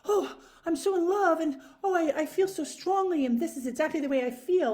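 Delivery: high, loud, over-the-top swooning; mock gushing lover. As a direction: ham up the lovesick gush. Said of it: "I don't necessarily respond with that very, like".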